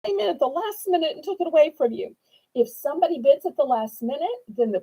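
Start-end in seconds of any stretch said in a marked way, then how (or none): 0.0-2.1 high, whimpering pout; mock whiny disappointment. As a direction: whine it like a sore loser.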